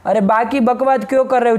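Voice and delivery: ordinary talk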